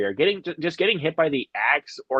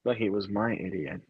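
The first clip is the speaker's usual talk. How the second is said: soft downcast line; 4 semitones lower, 9 dB quieter than usual. Retold or imitated. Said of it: "he looks down and is like".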